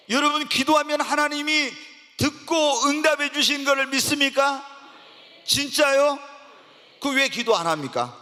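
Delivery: loud, high, fervent sermon delivery; preaching at full force. Acted. Preacher urging his congregation to pray, preaching at full force. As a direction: drive it home to the whole congregation.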